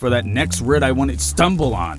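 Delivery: normal talking voice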